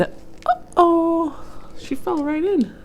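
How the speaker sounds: playful shocked storyteller; mock alarm. A narrator's reading. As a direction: gasp at the tumble for the kids. Narrator reacts to the fall.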